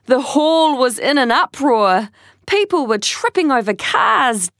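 ordinary delivery

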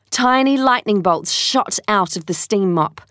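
plain reading voice

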